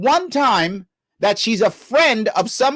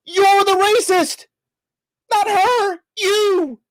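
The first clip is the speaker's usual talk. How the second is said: outraged shout; 10 semitones higher, slower than usual. Real, not acted. Host hits back at co-host in cracking outraged voice. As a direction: hurl it back at him near tears.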